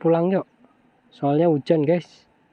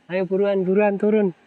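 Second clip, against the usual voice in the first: high, urgent hurry; 6 semitones higher than usual. Real, not acted. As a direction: rush the drone down, higher. Rain coming, hurrying drone down.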